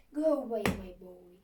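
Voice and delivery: loud, booming go-away; towering woman's loud dismissal; giant woman's rebuff. Acted. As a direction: shoo the boy off loudly. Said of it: "said the big, tall woman".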